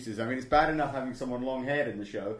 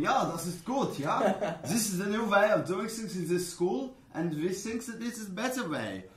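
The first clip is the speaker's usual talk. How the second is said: hammed-up German accent, pitched higher; camp, higher-pitched German; 7 semitones higher than usual. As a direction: put on a comic German accent. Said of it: "I just stick to German".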